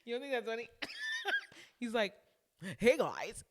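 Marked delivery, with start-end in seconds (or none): 2.8-3.4 loud put-on greeting with a swooping pitch; mock-eager, swooping hello; imitating his greeting. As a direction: mimic a guy calling hello to the room. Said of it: "literally in that tone".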